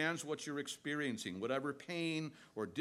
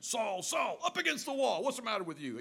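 barked high and loud like a cop; hammed-up arresting officer yelling; mock angry policeman; 7 semitones higher, 4 dB louder than usual. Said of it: "they hear an angry voice, like the voice of an arresting policeman".

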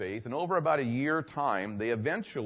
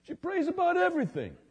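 much higher, astonished retold voice; amazed man; 11 semitones higher than usual. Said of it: "He goes".

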